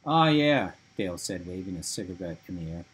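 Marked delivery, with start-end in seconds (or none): 0.1-0.7 loud exclamation. Acted. Dale suddenly remembering.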